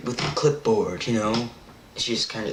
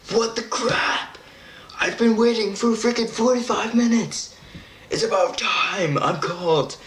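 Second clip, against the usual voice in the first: retold indignant whine, pitched way up; exasperated outburst; 9 semitones higher than usual. Patient griping about the long wait, high exasperated mock outrage.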